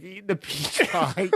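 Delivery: ordinary talk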